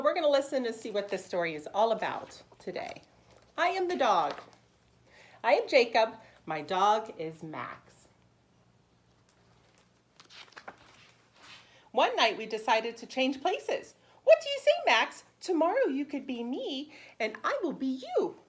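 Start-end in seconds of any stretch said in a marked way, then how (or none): 3.6-13.9 bright, lively read-aloud for preschoolers; animated picture-book narration; storybook reading. Acted. Teacher reads picture book aloud. 14.2-18.4 eager suggestion. Acted. Boy proposes swap to dog.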